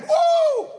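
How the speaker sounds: very high shouted woo; exultant whoop. Real, not acted. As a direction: cry out in triumph. Preacher's exultant cry.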